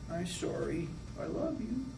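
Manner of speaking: very soft, small apology; remorseful child. Acted. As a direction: play a child meekly making up after a tantrum. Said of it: "shift in the child's demeanor".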